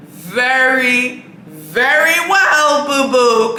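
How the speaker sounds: slow, drawn out and loud on very well; sassy emphasis. Real, not acted. Telling a reviewer how VIPs are treated, sassy drawl. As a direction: stretch it out knowingly.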